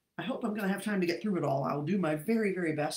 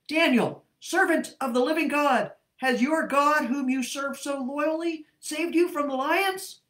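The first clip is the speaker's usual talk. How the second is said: higher and louder, calling out anxiously into the den; 7 semitones higher, 8 dB louder than usual. Acted. King at dawn calling to Daniel in the lions' den, anxious loud call. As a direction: call down into the pit, afraid of the answer. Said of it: "he called out anxiously".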